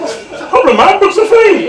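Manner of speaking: high, laughing mock swagger; laughing, cocky retort; cheeky retort. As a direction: brush off the librarians, grinning. Narrator at library.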